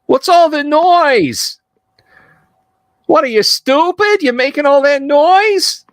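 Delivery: very high, squawking scolding woman; comic high-pitched neighbour's scolding; woman yelling down. Acted. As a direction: holler down from a window in a high, stretched-out falsetto. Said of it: "she yells down".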